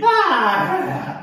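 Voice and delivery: loud high-pitched release yell; weird yogi yell. Shown as a demonstration. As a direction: let out a loud wild cry on the exhale. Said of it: "that was way too weird".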